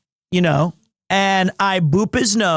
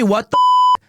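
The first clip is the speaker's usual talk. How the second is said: startled; 4 dB louder than usual. Real, not acted. Startled outburst mid-stream.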